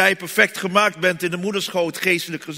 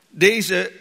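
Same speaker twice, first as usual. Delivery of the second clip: normal talking voice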